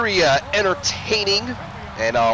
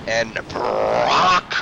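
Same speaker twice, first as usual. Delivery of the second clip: lightly done ring announcer hype, the name held and then yelled; a hint of a fight promoter hyping his champion; Paul Heyman impression; 4 semitones lower, much slower than usual. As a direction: hype up the challenger's name with a drawn-out build and a shouted finish. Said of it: "Paul Heyman accent there".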